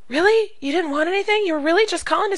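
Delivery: high, amazed disbelief; imitating a customer stunned by a no-sales call; surprised customer. Customer surprised a seller called just to wish Happy New Year, high amazed voice. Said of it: "they're gonna be surprised".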